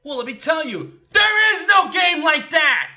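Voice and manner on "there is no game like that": high, loud, slowed-down shout; outraged yell; exasperated shout. Real, not acted. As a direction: shout the rebuttal, stretching out each word. Exasperated rebuttal to the actress.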